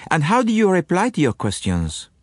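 fussy little Belgian asking his friend; French-accented Poirot. A narrator's reading. Poirot questions Hastings.

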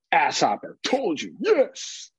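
imitating Wes showing off his word; proud toddler. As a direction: answer like a pleased small child. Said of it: "Wes, what is that animal?".

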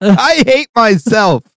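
drawn-out, high self-pitying wail; mock despair. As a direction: wail it slowly and high, joking. Mock despair after intervention joke.

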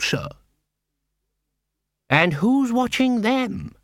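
a tense assassin leader grilling his deputies; suspicious guild head. Acted. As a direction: interrogate them coldly. Cruce questioning the council.

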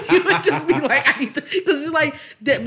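high, loud laughing words. Real, not acted. Friend cracking up over brother's stories, laughing. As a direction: laugh through the line.

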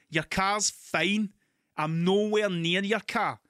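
slow, overdone mouthing of each word; mouthing through the window. Retold or imitated. To the woman through two car windows, slow exaggerated mouthing. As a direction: spell it out slowly so she can lip-read. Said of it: "talking with your hands kind of thing".

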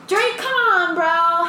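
high, loud playful begging; mock pleading. Friend begging a rapper for a show, mock pleading. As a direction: wail a plea to an absent star.

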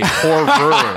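"horror" in two careful, separated syllables; consciously pronounced horror. Shown as a demonstration. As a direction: sound out both R's of "horror" deliberately. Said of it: "have to actually consciously say".

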